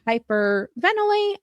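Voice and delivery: normal talking voice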